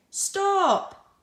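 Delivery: high, loud shouted Stop. Shown as a demonstration. Teacher reading Bob's line aloud, shouting. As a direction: bark out Stop to halt someone. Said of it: "shouted Bob".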